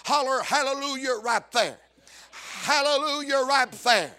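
rhythmic, rising gospel preaching; full-force preaching. Acted. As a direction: preach it to the congregation, building to a shout.

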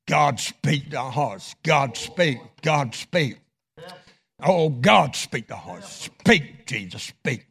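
earnest altar-call prayer; pleading prayer. Real, not acted. Leads closing prayer.

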